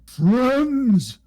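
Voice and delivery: high, loud, drawn-out monster voice; friendly large creature. Pretend. Large creature begs help from the adventurers, friendly booming plea. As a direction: call out slowly, eager and pleading. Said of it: "giving off friendly vibes".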